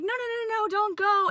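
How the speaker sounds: high, soft begging; lightly voiced pleading Sam. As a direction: plead with her to stay. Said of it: "Sam was like".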